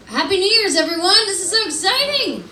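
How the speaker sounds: high-pitched, gushing holiday cheer; excited greeting. Real, not acted. Comedian greets crowd for New Year's, excited. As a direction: lift it high and celebrate.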